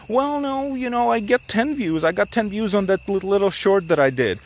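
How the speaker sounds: high, whiny mock viewer voice. Mocking a viewer's excuse.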